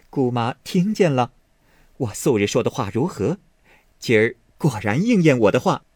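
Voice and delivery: laugh, then speak up triumphantly. Acted. Fengjie nudging her aunt, proven right, laughing. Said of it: "笑推薛姨妈道".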